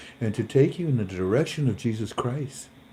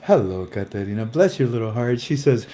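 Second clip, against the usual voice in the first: ordinary talk, nothing marked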